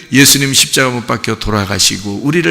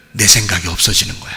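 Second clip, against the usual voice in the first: normal talking voice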